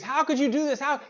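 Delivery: high pitched mock yell; hammed-up scream. Monk yelling at the one who broke the rule, high mock scream. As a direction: let the outrage burst out, high. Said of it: "Like, screams".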